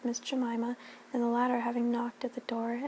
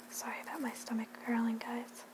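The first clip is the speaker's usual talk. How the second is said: much softer, hushed apology; soft aside; 8 dB quieter than usual. Real, not acted. Reader apologising to listeners for her stomach growling, hushed. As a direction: murmur a real apology to the listeners.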